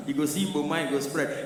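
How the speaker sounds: ordinary talk, nothing marked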